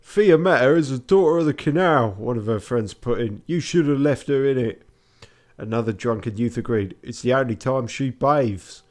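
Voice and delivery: mock Venetian drunk in default Cockney; Cockney accent. Drunken friends mock Fiammetta, loud Cockney accent. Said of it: "Default Cockney".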